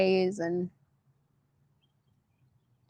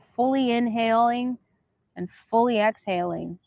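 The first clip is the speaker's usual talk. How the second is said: guided breathing; 2 semitones higher than usual. Acted. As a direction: talk them through each breath, unhurried. Leads viewers in breathing.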